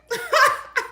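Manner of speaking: short, high-pitched giggle; high laugh. Real, not acted. Woman laughing at what she won't say, high giggle. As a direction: giggle high at your own remark. Laughs at her own remark.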